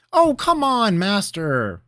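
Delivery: high, loud eager plea; pleading. Acted. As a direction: beg him to go on. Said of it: "Rabineau pleaded".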